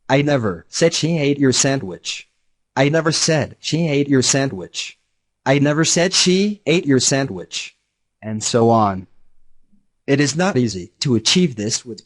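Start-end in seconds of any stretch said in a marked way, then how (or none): 0.1-2.3 "said" lifted and leaned on; stress on said. Shown as a demonstration. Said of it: "depending on which word the speaker accents". 2.8-5.0 "never" punched and held; stress on never. Shown as a demonstration. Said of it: "depending on which word the speaker accents". 5.5-7.8 "she" raised and leaned on; stress on she. Shown as a demonstration. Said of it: "depending on which word the speaker accents".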